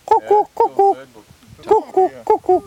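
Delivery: high, repeated pigeon hoots; pigeon call. Retold or imitated. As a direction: imitate a cooing pigeon in paired notes. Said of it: "We call them kocho kocho".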